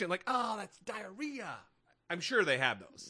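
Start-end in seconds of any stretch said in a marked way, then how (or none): 0.3-1.6 high, drawn-out revulsion; slow, pitched-up mock gag reaction; mock disgust. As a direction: react to a foul smell, hammed up. Acting out sniffing a foul sticker.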